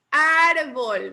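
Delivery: Árbol with a loud, held AR; Árbol, AR punched, bol falling away; stress on Árbol's first syllable. Shown as a demonstration. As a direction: hit the AR in Árbol hard. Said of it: "En ar".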